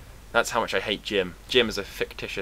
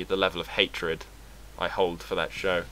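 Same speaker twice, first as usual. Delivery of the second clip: ordinary delivery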